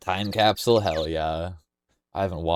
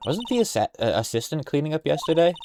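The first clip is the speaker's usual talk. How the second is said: high-pitched, questioning character voice; high, curious sidekick; game sidekick voice; 7 semitones higher than usual. Acted. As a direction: ask it in a high put-on voice. Voicing the game's assistant character.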